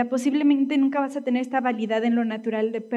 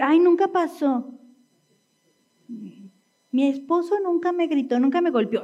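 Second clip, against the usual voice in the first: negación aguda, fingiendo inocencia; negación fingida; 5 semitones higher than usual. Acted. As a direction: finge que nada pasó, con la voz subida. Finge negar lo que pasó. (negación fingida, en tono agudo)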